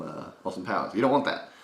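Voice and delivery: ordinary talk, nothing marked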